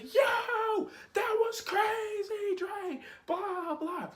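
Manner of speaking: high excited cheering crowd; friends cheering him on. Retold or imitated. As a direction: hype him up like friends at the pool. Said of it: "everybody's like".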